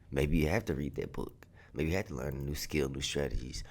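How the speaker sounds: ordinary delivery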